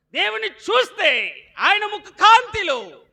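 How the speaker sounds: slow, high and loud; slow ringing pulpit line; emphatic preaching. Acted. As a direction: lift it up slowly.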